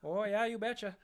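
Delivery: high, hammed-up Minnesota "you betcha"; Minnesota accent. Cohost finishing the bit, in a hammed-up Minnesota accent. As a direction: Fargo mom, laid on thick. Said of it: "Minnesota Fargo accent".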